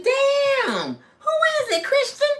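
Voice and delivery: high, eager prodding; excited begging. Retold or imitated. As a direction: squeal for the gossip.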